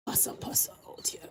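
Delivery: whispered aside under the breath; hushed unvoiced mutter. Real, not acted. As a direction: whisper it to yourself.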